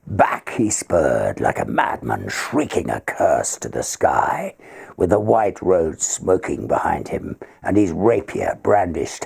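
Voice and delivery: measured, rhythmic reading of a ballad; poem recitation. A narrator's reading. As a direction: recite it like a dramatic old ballad.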